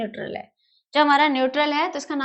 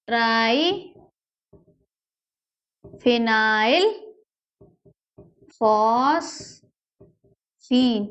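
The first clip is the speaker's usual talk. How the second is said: long-drawn syllables with wide gaps; slow dictation; much slower than usual. Shown as a demonstration. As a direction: dictate it at writing speed. Dictates the name while writing. (अक्षर-अक्षर, बहुत धीरे बोलना)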